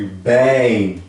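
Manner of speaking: BEM stretched long; drawn-out vowel. Shown as a demonstration. Teacher contrasting it with pet, long drawn-out BEM. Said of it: "pode ver que prolonga".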